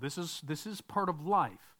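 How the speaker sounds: usual speaking voice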